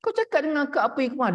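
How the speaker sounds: high-pitched mimicked snapping; retold snapping demand. Upset wife repeatedly demanding an answer, retold high snapping demand. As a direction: fire the question as an insistent mock snap. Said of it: "dia bentak-bentak kita".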